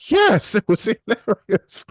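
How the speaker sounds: real laugh breaking through the words, high and loud; amused high laughing reply. Real, not acted. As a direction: laugh out the answer, drawn out. Laughing at toddler trolling clip.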